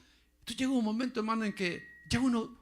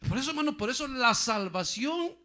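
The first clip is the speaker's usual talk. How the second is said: usual speaking voice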